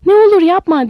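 high, loud, desperate plea; pleading. A narrator's reading. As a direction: beg him to stop, panicked. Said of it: "diye yalvardı sonra".

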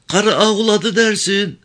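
high, pleading village woman voice; worried old mother. Acted. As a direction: press her son with anxious advice. Mother coaching son.

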